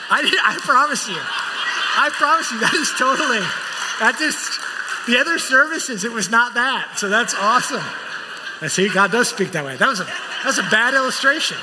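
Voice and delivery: high, loud, laughing protest; amused protest. Real, not acted. Preacher to congregation as his illustration backfires, amused protest. Verse fits by accident, audience laughs.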